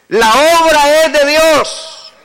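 high, slow, ringing sermon cry; full-force preaching. Real, not acted. Preacher urges congregation to have patience, fervent slow preaching. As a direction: proclaim it high with drawn-out words.

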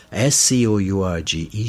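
normal talking voice

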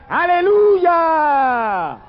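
high, loud, long drawn-out cry; shouted hallelujah. Acted. Preacher voicing heaven's crowd, long high shout. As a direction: ring it out like a heavenly multitude. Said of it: "y gritaban".

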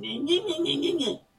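put-on whining child. Preacher mocking himself as a whining child, high whine. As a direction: whimper like a crying child. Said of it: "como un niño chino".

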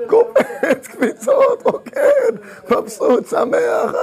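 very high, laughing bursts; ecstatic dancing joy. Acted. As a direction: bounce each word up high with laughter. Describes him leaping and dancing.

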